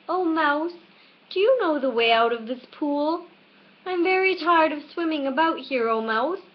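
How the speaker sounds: put-on girl politely asking for help; lightly voiced young Alice. A narrator's reading.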